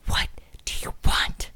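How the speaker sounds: whispered, curt groggy mom; sleepy mother annoyed at an early call, whispered; imitating her half-awake mom, whispered; grumpy mom whisper. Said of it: "she was like".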